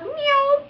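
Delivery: quick high "meow" swerve noise; high-pitched zoom; imitated car zoom. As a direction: mimic a car darting off. Imitates car darting into plaza.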